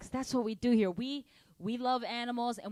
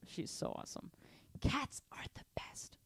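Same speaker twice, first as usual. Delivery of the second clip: whisper; 14 dB quieter than usual. Real, not acted. Soft aside about his cat.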